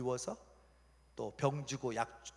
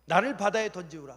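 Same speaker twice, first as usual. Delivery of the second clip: loud, raised declaration; acted forceful admission; bold confession; 5 semitones higher, 9 dB louder than usual. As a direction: proclaim your guilt to everyone. Jonah declares himself to the sailors.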